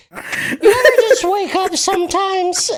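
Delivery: put-on high, slow laughing old lady; Doris old lady voice. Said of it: "read it in her old lady voice".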